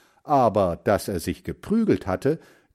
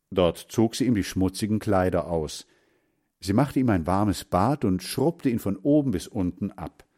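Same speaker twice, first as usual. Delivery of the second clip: usual speaking voice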